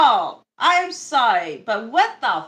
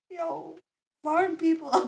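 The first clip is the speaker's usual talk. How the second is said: usual speaking voice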